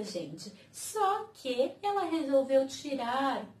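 usual speaking voice

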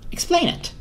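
high, fast, demanding squirrel; Harold the squirrel. Acted. Harold demands to know who is male or female, fast and high. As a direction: press the question quickly and high.

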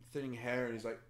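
usual speaking voice

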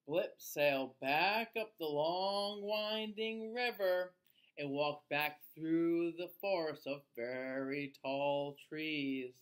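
plain reading voice

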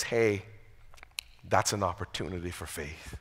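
very low, soft voicing of God; hushed reassurance. God looks at our deficiency without shaming us, hushed reassurance. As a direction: drop right down, gentle and intimate.

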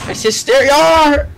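high loud pirate Yar; pirate yell. Acted. Pirate calling out.

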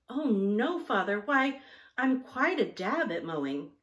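high, loud, breezy protest; breezy confidence. A narrator's reading. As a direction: brush off the worry with bright confidence. Daughter breezily reassures alarmed father.

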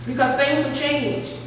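usual speaking voice

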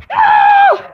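very high, loud no; full scream. Real, not acted. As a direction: scream at the screen. Final loss moment.

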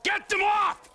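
sudden shout. Acted.

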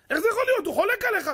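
high-pitched scandalized protest; mock incredulity. Rabbi voicing someone shocked that a colleague disagrees, mock incredulous. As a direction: act out being astonished at a challenge.

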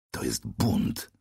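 slow, low angry hiss with stress on bunt. A narrator's reading. Narrator hissing at the usurping angel, slow low hiss. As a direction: hiss it slowly through anger. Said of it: "syknąłem".

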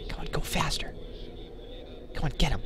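urgent whisper; hushed urging. Real, not acted. Player urging his sneaking character on, hushed. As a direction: whisper it, egging someone on under your breath.

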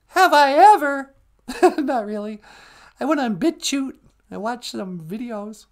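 high comic voice, loud at first; high-pitched with a giggle; squeaky sidekick. Pretend. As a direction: answer in a piping puppet voice.

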